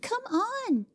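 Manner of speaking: put-on high, hurrying child's call; impatient girl's cry. Cora urging Jimmy back: impatient high girl voice. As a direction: hurry him along. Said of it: "cried little Cora".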